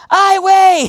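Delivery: very high, loud exclamation in Spanish; startled outburst at the sight; shocked neighbor. Retold or imitated. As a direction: neighbor crying out at his burning roof. Mexican neighbor seeing fire.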